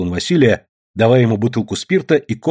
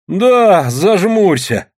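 high, louder huffy retort; aggrieved, drawn-out comeback; offended; 7 semitones higher, 4 dB louder, much slower than usual. A narrator's reading. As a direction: answer back in a hurt huff, pitched up. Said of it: "обиженно отозвался Василий".

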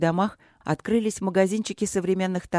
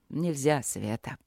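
sad explanation; 3 semitones lower, 5 dB quieter, slower than usual. A narrator's reading. Soft, low, sad reply. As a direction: lower and much softer, sorrowful. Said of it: "печально объяснил мастер".

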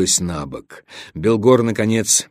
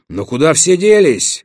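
exclaiming; 6 semitones higher, 3 dB louder than usual. A narrator's reading. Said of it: "воскликнул Нордек".

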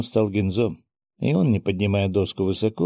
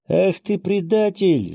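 high-pitched female outcry; accusing cry; 10 semitones higher, 3 dB louder, slower than usual. A narrator's reading. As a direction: cry out at a traitor, pitched well up. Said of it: "закричала Микана". (возмущённый крик)

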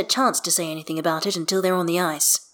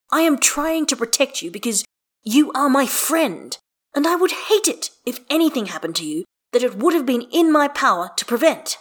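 higher-pitched, emphatic protest; earnest insistence; 8 semitones higher, 4 dB louder than usual. Acted. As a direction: insist on it, exasperated but caring. Heated declaration of friendship in quarrel.

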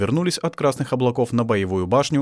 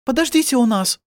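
put-on very high girlish voice; high-pitched invitation; chorus of girls; 14 semitones higher, 4 dB louder than usual. A narrator's reading. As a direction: plead as worried girls. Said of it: "сказали все девушки".

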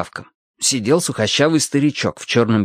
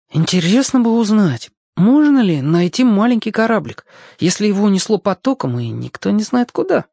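higher-pitched, as if just wondering aloud; feigned casual; 9 semitones higher than usual. A narrator's reading. Boy fishing for help from the old cobbler, feigned casual. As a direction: float it as an offhand question, pitched up. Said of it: "будто между прочим".

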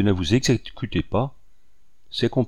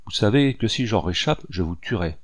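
ordinary delivery

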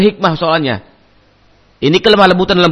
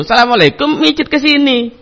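mock stranger; 7 semitones higher than usual. Imitating a stranger asking a massage.

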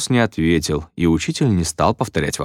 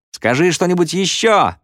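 high-pitched cry from the crowd; raised, high voice; shouting pupil; 10 semitones higher than usual. A narrator's reading. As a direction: call out, egging him on. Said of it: "закричал другой".

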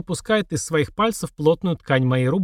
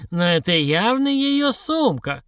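plain reading voice

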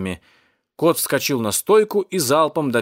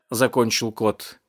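ordinary delivery, nothing marked

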